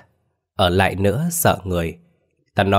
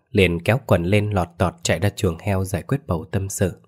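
ordinary delivery

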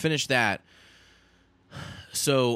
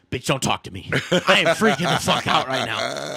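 snapping, louder and higher, half laughing; a panicked outburst at his wife, loud; retold freak-out; 4 semitones higher, 6 dB louder than usual. A panicked husband snapping at his calm wife, louder. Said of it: "I was like".

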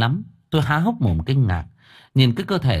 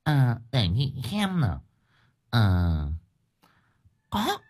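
slow, hesitant recollection with pauses; acted musing, feeling for a memory; pondering; 4 dB quieter than usual. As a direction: think aloud as the village wife, drawing out the words. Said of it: "ngẫm nghĩ".

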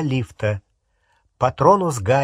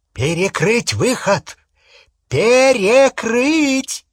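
high shout building to a drawn-out yell; urgent shouted order; 9 semitones higher, 6 dB louder, slower than usual. A narrator's reading. Yaxley orders the exit sealed, urgent shout. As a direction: shout it, then louder and longer.

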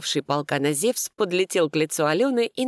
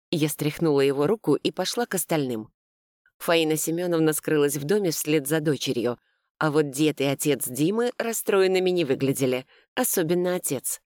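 ordinary delivery

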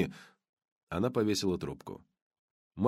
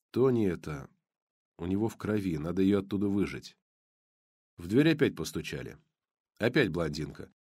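plain reading voice

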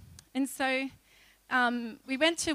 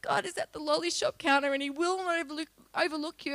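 quoting through laughter; 3 semitones higher than usual. Real, not acted.

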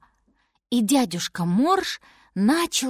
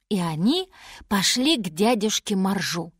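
ordinary delivery, nothing marked

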